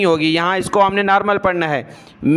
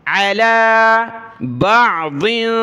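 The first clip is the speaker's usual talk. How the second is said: slow, drawn-out chanted recitation; Quranic recitation; 3 semitones higher, much slower than usual. Shown as a demonstration. Teacher models the verse for the student, slow chant. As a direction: recite each word long and even.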